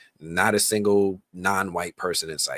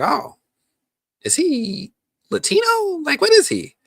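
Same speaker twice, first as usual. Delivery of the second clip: mock puzzlement; 6 dB louder than usual. Recalling first seeing a cartoon character, mock puzzlement. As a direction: squeal the question in exaggerated wonder.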